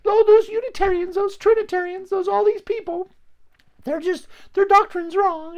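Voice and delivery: very high, squeaky mocking voice; mocking self-righteous believer. Preacher mocking believers who condemn others, very high squeaky voice. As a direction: lampoon the smug churchgoer judging everyone. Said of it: "and nobody's like".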